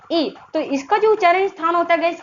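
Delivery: ordinary talk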